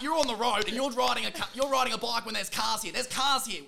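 put-on loud, high furious ranting at a kid; yelling at a kid like an angry old man. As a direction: blast him like a cranky fifty-year-old. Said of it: "switched into, like, a fifty-year-old man".

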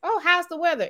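high, chirpy small talk; mock cheerful coworker. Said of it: "I can't stand".

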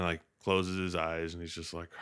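usual speaking voice

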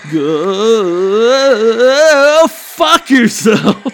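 slow, loud, stretched-out curse through a laugh; exasperated laughing snarl; drawn-out curse. Real, not acted. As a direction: drag every word out, laughing at your own anger. Ranting at careless baggers.

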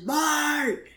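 high yelled name; calling out. Retold or imitated. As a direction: shout his name up high. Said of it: "she starts screaming for Bart".

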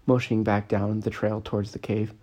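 plain reading voice

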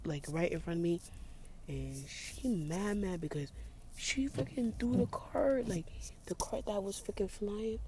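hushed gossip. Real, not acted. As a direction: talk under the breath so she won't hear. Narrating scene outside quietly.

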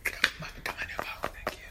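breathy stage whisper; whisper yelling. Real, not acted. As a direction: whisper it out hard. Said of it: "Why you whispering like it's".

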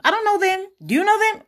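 high, loud mock incredulity; incredulous. Real, not acted. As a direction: squeak it out in disbelief. Incredulous repeated denial.

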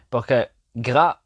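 usual speaking voice

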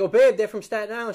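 excited retelling. Retold or imitated. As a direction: pass on the news eagerly. Retold excited wife quote.

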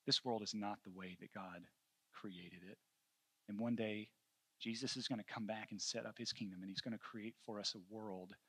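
soft, low fatherly comfort; hushed and tender, with long pauses; gentle consoling. Retold or imitated. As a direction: comfort a grieving little boy, quietly. Father consoling crying son, retold.

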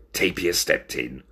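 usual speaking voice

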